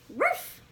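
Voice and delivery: a high yappy little bark; puppy bark. Acted. Puppy barking at the park, high yappy bark.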